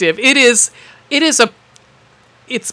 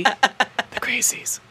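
mock whisper; 2 semitones higher, 11 dB quieter, much slower than usual. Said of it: "it's whispered".

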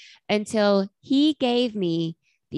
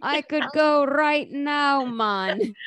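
drawn-out, higher mock Southern accent; 6 semitones higher, much slower than usual. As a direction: stretch it out in a hammy Southern accent. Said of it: "Was that his Southern accent".

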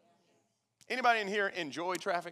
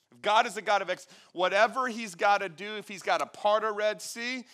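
raised, louder pulpit voice; fervent preaching; 5 semitones higher, 6 dB louder than usual. Real, not acted. Preacher urges a stuck congregation to move, fervent preaching. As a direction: drive it home in an urgent, high-pitched run.

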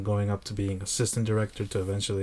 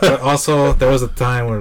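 laughing; 4 semitones higher, 11 dB louder than usual. Real, not acted. Still laughing after the joke.

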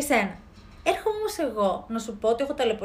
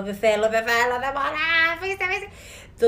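whiny, slowed mock screech, higher at the end; mock tantrum; 3 semitones higher, 4 dB louder, slower than usual. Rebelling against the parents, a hammed-up tantrum. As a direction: throw a pretend childish fit. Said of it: "να τσιρίζω και να φωνάζω".

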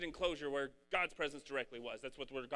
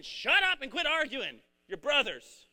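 louder, slow, scolding command; stern rebuke; 7 semitones higher, 9 dB louder, slower than usual. Retold or imitated. God telling quarreling brothers to stop, scolding. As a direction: scold them like a fed-up father.

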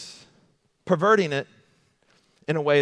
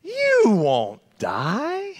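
even higher and slower, sliding snake voice; sly serpent; 8 semitones higher, much slower than usual. Retold or imitated. Preacher repeats Satan's lie to Eve, drawn-out and sly. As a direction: stretch every word like a tempting snake. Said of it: "that's the way he said it too".

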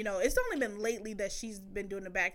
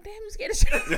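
high, loud, laughing tease; laughing mockery of the kid's fear; teasing laugh; 11 semitones higher, 10 dB louder than usual. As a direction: tease the scared daughter through laughter. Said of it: "I was like".

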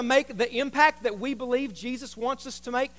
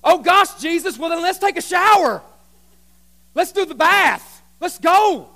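high, shouted enthusiasm; Peter overexcited; 5 semitones higher, 9 dB louder than usual. Acted. Peter urging Jesus to take a shower, excited shouting. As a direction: shout it like you can't wait. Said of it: "So Peter says".

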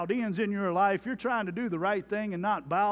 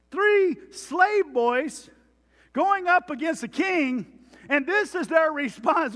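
amazed; 7 semitones higher, 5 dB louder, slower than usual. Real, not acted. Marveling at the boys' defiance.

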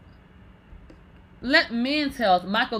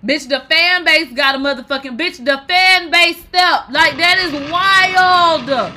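louder, higher, slowing to stretched words; hyped disbelief; 5 semitones higher, 8 dB louder, slower than usual. Real, not acted. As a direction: build up amazement.

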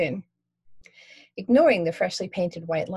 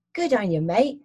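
a light Cockney cabbie, higher; friendly London cabbie asking; 3 semitones higher, 4 dB louder than usual. Acted. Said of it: "Cockney accent".

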